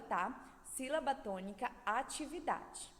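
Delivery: normal talking voice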